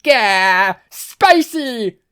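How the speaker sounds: very high, loud yelp; burn your mouth and shriek; pained scream. Acted. Tabe after eating reapers, screaming in pain. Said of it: "screamed out in agony".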